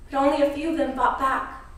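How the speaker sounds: normal talking voice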